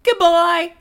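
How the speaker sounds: high, loud falsetto; high-pitched comic character; [name]'s squeaky voice. Acted. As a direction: call it in a put-on squeak. [name] answers in put-on voice.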